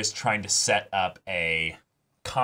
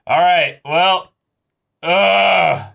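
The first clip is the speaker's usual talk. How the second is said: loud, high, defeated ugh; exasperated groan; 9 semitones higher, 11 dB louder, much slower than usual. Real, not acted. Cohost reeling from another death, loud exasperated groan. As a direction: let the frustration burst out. Reeling after the sad episode.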